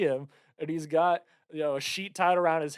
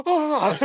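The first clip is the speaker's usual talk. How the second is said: high loud startled shriek; mock scream; 12 semitones higher, 6 dB louder than usual. Father opening the door, high startled shriek. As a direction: shriek like you just got caught. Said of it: "he's just like".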